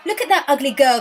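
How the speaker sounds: loud, high taunting shout; mocking jeer. Villagers mocking the rough-faced girl, loud jeering taunt. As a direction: jeer at her like a cruel crowd. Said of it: "they laughed".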